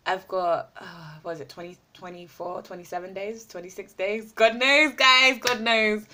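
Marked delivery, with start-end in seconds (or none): 4.3-6.1 exasperated shrug. Real, not acted. Unsure how many days.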